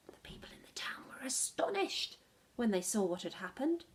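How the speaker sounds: usual speaking voice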